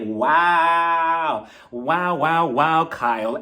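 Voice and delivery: long drawn-out first wow, then falling; appalled disbelief. Acted. Host reacts to Kyle's accusation, drawn-out appalled disbelief. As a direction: let the disapproval sink in word by word.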